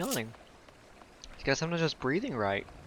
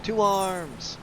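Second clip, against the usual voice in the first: a higher, ringing call to arms; rally the troops, head up; grito de guerra do NPC; 8 semitones higher than usual. Acted. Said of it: "I love how he says".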